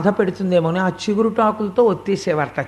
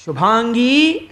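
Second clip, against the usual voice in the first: long, higher call with a rising end; కృష్ణుడు calling loudly; 4 semitones higher, 4 dB louder, much slower than usual. Acted. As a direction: call a far-off cow by name, stretching it upward. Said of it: "అని గట్టిగా పిలిస్తే". (గట్టిగా, సాగదీస్తూ పిలుపు)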